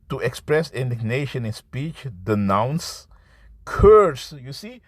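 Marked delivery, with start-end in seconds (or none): 3.6-4.3 curse, flung out high and loud; preacher's shouted curse; emphatic shout. Real, not acted. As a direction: bark the word curse at them. Preacher hurls the definition.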